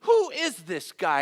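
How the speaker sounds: acted outrage at an insolent stranger; upset, indignant. David demanding to know about Goliath, upset and indignant. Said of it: "But David's upset".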